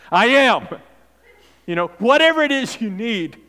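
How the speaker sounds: rising, loud preacher cadence; preaching at full force. Acted. Preacher answering each need with I am, full force.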